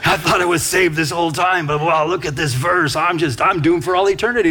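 hammed-up despair, rising to a near shout at the verse; mock panic. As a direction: act out a believer sure they are damned. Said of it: "it can even beat you up".

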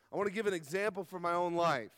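usual speaking voice

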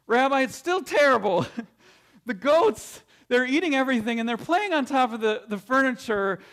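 desperate father. Acted. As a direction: beg the rabbi for help, at wit's end. Desperate father complains again.

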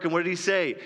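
ordinary delivery

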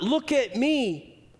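"Look at me" drawn out high and proud; self-important. Shown as a demonstration.

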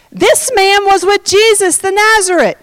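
high-pitched public accusation; accusing servant girl. Acted. Servant girl telling those on the porch, high and accusing. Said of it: "another servant girl saw him and said".

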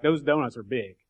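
ordinary delivery

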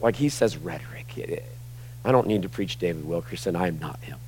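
ordinary talk, nothing marked

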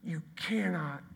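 hushed whisper; whispered emphasis. Real, not acted. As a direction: breathe it as a secret. Whispered after loud take.

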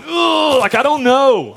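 high, exasperated groan into words; acted frustration. Believer groaning to the Father in prayer, acted high-pitched frustration. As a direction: groan it out high, like someone at a loss. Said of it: "there's just frustration".

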